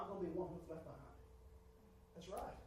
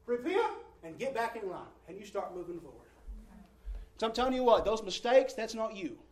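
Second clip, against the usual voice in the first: rising, louder preaching at full force; urgent sermon build, loud and high; 6 semitones higher, 9 dB louder than usual. Acted. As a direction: drive it home to the congregation.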